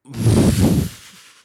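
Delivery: a loud, high, sudden laugh that falls away; burst of laughter. Real, not acted. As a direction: let a real laugh burst out. Reacts to boss getting fired.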